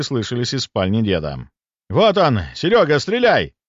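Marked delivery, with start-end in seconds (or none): 1.9-3.5 high, loud, urgent yell; excited hunter's shout. Acted. Hunter urging Серёга to shoot, urgent shout. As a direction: yell the order to a partner.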